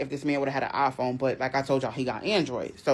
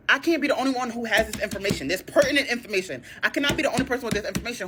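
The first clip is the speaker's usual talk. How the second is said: fast, laughing disbelief; laughing rant; 7 semitones higher, faster than usual. Retold or imitated. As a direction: rattle it off through laughter.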